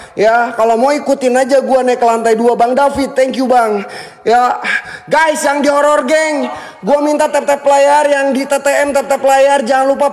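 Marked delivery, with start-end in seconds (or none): none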